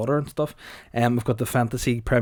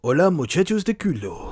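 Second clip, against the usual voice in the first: theatrical Spanish announcer, raised pitch; Spanish narrator; 4 semitones higher than usual. Acted. Podcaster introducing Ronaldo's bit, mock Spanish narrator voice. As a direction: put on a grand Spanish narrator's accent. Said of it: "I'll do my Spanish narrator's voice".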